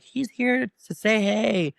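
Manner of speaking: high, drawn-out classroom announcement; put-on high introduction voice; teacher introducing visitor. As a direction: stretch it out high for the whole class.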